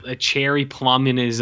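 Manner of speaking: normal talking voice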